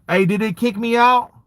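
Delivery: normal talking voice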